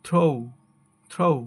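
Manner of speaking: "throw" as "throh-oo"; correct pronunciation. Shown as a demonstration. Teacher modelling the correct word, "throw" with gliding o-u. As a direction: let the o of "throw" slide into oo. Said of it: "সঠিক উচ্চারণ হবে".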